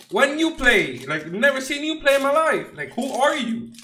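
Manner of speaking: high, loud, teasing disbelief that eases off; mock outrage, settling down toward the end; mock indignant yelling. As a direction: roast the card out loud. Mocking an unknown player.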